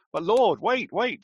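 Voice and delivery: loud, high-pitched pleading interruption; urgent protest. Acted. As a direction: cut in as an alarmed Martha, voice jumping up. Martha protests at opening the tomb.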